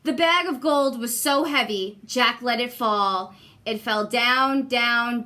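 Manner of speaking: ordinary delivery, nothing marked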